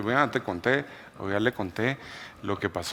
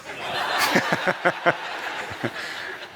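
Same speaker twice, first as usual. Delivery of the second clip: laughter; 7 semitones higher than usual. Real, not acted. Laughing at his own doubt.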